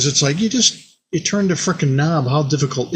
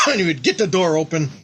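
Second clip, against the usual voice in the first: ordinary talk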